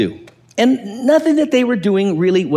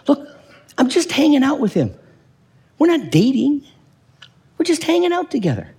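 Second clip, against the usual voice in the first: higher, lightly voiced woman; quoting the woman; 8 semitones higher than usual. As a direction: play the woman brushing it off. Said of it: "She says".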